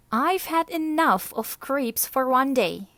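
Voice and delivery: loud, high and fed up; fed-up retort. Acted. Martina snaps at the creepy lizard, loud and high. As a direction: let him have it, sharply.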